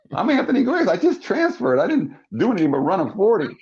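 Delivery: high-pitched self-mocking protest; incredulous. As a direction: play up disbelief at yourself. Disbelieves he could be drafted.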